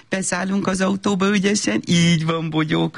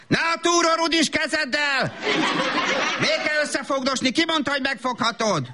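scolding; 9 semitones higher than usual. Acted. Mother scolding child in shop.

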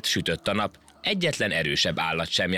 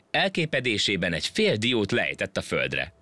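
ordinary delivery, nothing marked

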